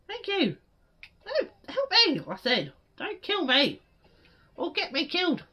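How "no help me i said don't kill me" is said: normal talking voice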